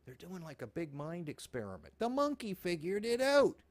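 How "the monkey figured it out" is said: triumphant. Real, not acted. Mocking triumph.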